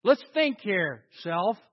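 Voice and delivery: steady yourself out loud, slowly; firm self-talk. Retold or imitated. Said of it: "talk to yourself and say".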